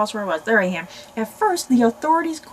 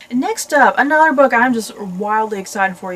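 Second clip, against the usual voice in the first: louder, bursting with excitement; excited announcement; 10 dB louder than usual. Real, not acted. Booktuber introduces the next book to viewers, excited. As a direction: announce it like you can't wait.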